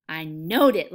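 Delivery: loud, emphatic outburst; exclaimed with conviction; crying out. A narrator's reading. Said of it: "Lenny cried".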